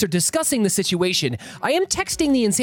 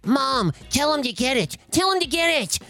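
lightly voiced chanting child; whiny kid; 5 semitones higher than usual. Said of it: "the kid keeps chanting this".

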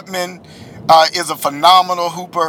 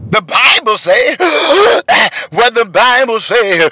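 slow, higher preacher chant with a gasp; preacher hooping; 3 semitones higher, 3 dB louder, slower than usual. Retold or imitated. Mocking a preacher hooping to the church, slow, with a gasp. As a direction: whoop it like a preacher catching breath. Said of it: "the preacher voice".

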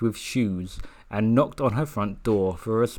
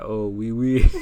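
hammed-up French exclamation, louder; mock French; 6 dB louder than usual. An eight-year-old back from France, mock French. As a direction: play a kid showing off fake French. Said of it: "came back being like".

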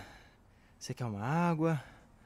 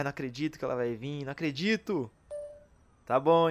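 ordinary delivery

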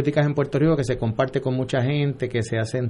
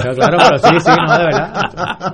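louder, higher, laughing through the words; 6 semitones higher, 9 dB louder than usual. Real, not acted. Candidate to radio hosts, laughing along at the joke. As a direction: laugh it off, agreeing.

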